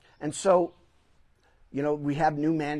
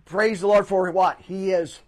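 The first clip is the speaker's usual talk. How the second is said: louder, rising preacher's call; preaching; 4 semitones higher, 9 dB louder than usual. Acted. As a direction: lift the congregation with it. Preacher building to climax.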